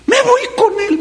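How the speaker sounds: mock a widow crying out to follow her husband; a preacher mimicking a dramatic widow; mock wail. Mocked widow wailing.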